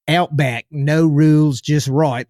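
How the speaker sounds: amateur Aussie slogan voice; attempted Australian accent. Shown as a demonstration. Podcast host showing listeners his limited impression, attempted Australian accent. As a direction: have a go at a broad Australian accent. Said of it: "I wish I could do a great Australian accent".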